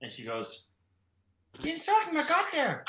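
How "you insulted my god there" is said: high Irish nun voice; a high-pitched Irish nun; Irish accent. Retold or imitated. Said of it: "I can do the Irish woman's accent".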